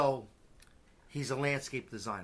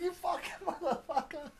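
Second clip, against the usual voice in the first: put-on very high, softer kid voice with laughter; imitating a cursing little girl; 5 dB quieter than usual. Quoting a small girl swearing at dad, mock child voice.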